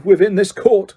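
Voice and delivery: father drowning out his son. A narrator's reading. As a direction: drown out the boy. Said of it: "speaking more loudly".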